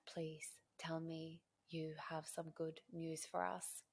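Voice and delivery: soft, slow retold pleading; hushed plea. Buyer begs agent for good news, hushed and pleading.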